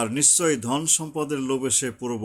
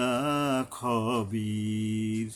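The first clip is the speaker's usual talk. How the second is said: slow, drawn-out chanted recitation; Quran recitation; 2 semitones lower, much slower than usual. Shown as a demonstration. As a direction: intone each word, stretching the vowels. Recites verse with rules.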